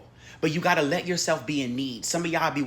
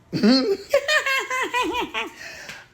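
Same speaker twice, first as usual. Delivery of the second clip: high squealing laugh; 4 dB louder than usual. Real, not acted. Laughing at her cat, high squeal.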